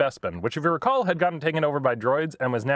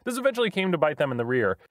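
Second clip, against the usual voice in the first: ordinary talk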